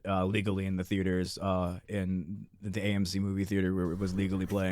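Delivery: low, soft mock-innocent insistence; deadpan sarcasm. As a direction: sell the word legally with a straight face. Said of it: "He's selling it".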